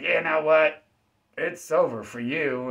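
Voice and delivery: loud mock threat from a thug; threatening attacker. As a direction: bark it out like a street tough. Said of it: "coming up to you like".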